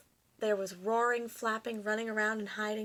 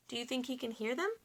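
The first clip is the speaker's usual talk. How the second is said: ordinary delivery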